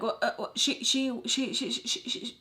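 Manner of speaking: stammering, stuck on the first sound; startled stutter, re-enacted. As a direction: imitate tripping over the word, getting nowhere.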